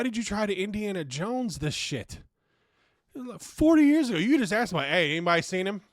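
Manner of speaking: loud, fast and high, fed up; exasperated outburst. Real, not acted. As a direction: throw your hands up and rant, quick. Exasperated rant, much louder.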